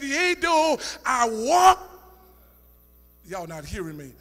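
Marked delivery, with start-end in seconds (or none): 0.0-1.8 slow, loud, drawn-out psalm line; drawn-out scripture. Acted. Preacher to congregation, slow loud scripture. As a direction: stretch the word walk out.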